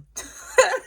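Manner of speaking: high incredulous burst of laughter; a squeal of a laugh. Real, not acted. Vlogger bursting out laughing at Braden's snitching, high laugh.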